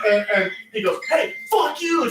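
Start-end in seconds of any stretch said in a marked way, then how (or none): 1.1-2.1 high, slow shouted insult; mock drunk yell. Drunk friend shouting at the guy parked nearby, high and slow. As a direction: drag it out at the other driver. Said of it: "to the guy who was parked next to us".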